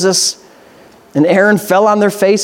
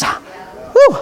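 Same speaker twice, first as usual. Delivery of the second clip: whew. Real, not acted. Reacting to harsh verse.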